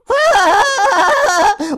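high, loud mock cackle; high loud cackle aping the monster; wild shrieking cackle. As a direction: shriek with laughter like a maniac. Game monster laugh echoed.

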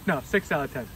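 ordinary talk

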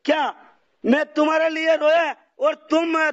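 ordinary talk, nothing marked

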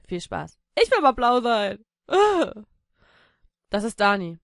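ordinary talk, nothing marked

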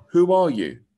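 loud, high guard's challenge; demanding. A narrator's reading. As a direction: demand to know, forcefully. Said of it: "he demanded".

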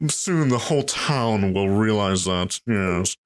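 slow, lightly put-on posh English accent. Joja's Morris gloating to shopkeeper Pierre, in a slow posh accent.

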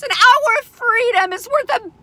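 high, loud, slow proclamation; drawn-out goose shout; honking goose. A narrator's reading. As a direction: squawk it out grandly, stretching words. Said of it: "honked the goose".

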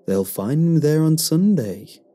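insistent moon voice. A narrator's reading. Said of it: "the moon said again".

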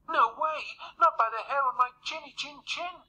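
high squeaky piglet defiance; crying-out little pig. A narrator's reading. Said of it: "cried the second little pig".

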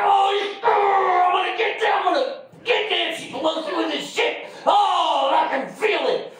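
mock excited rioter. Comic mocking a rioter, hammed-up giddy shout. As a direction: play a thrilled rioter about to strike. Acting rioter chasing Pelosi.

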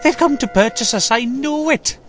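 high, loud panicked voice; frantic little engine wail. A narrator's reading. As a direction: cry out in high-pitched dismay. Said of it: "wailed Peter Sam".

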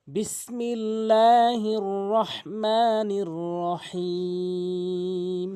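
slow melodic Quranic recitation, drawn out. Shown as a demonstration. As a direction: intone it slowly like tilawat. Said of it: "তো আসুন শুরু করছি।".

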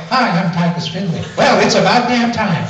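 a weak stand-in for Tychus; wrong Tychus voice. Pretend. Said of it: "can you imagine that voice with somebody else".

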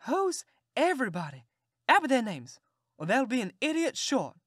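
high, loud threatening scold; furious aunt. Acted. Aunt threatening the boy for names, high and loud. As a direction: threaten him with her fingers itching.

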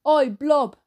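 loud, rough call from behind; shout. A narrator's reading. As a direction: shout out a taunting hail. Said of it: "came a shout from behind them".

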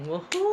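high-pitched "woo-hoo" cheer; whooping cheer; playful whoop. Real, not acted. Playful cheer ending the intro.

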